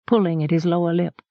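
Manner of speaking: usual speaking voice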